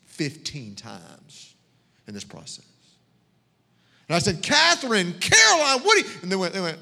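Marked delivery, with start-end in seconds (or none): 4.4-6.2 calling out. Retold or imitated. Calling daughters, found them.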